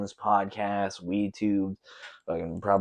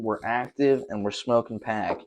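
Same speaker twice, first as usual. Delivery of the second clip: ordinary talk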